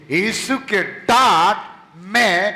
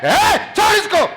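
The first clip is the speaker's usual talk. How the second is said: high loud barking rebuke; commanding shout; 11 semitones higher, 5 dB louder than usual. Shown as a demonstration. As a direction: shout the spirit out with authority. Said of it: "क्या बोलते हैं?".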